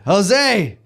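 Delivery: calling loudly offstage. Real, not acted. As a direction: shout the name across the room. Calling Jose out from backstage.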